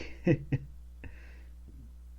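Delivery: quiet unvoiced chuckle; a breathy laugh to himself; soft airy laugh. Real, not acted. Laughs at own joke.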